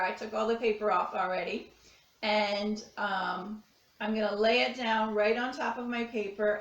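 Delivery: ordinary talk, nothing marked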